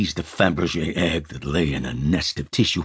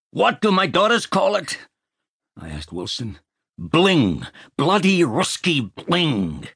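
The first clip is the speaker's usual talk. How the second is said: mock Wilson; 12 semitones higher than usual. Wilson parodied.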